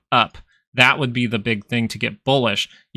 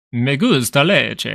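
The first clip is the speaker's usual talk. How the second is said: Italian-style Spanish; 3 semitones higher, slower than usual. Retold or imitated. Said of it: "he speaks Spanish like he's Italian".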